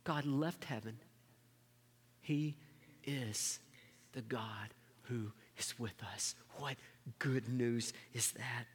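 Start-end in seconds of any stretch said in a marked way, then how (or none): 2.2-6.4 whispered, much softer reverent words; hushed whisper. Acted. Hushed emphasis in sermon. 6.6-8.7 hushed whisper. Acted. Hushed emphasis in sermon.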